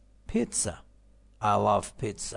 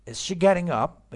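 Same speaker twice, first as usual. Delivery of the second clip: slow, loud, high question aimed up to "up"; rising intonation; 7 semitones higher, 8 dB louder, slower than usual. Shown as a demonstration. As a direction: model the yes-no tune, lifting "up". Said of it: "این صدا رو میره بالا".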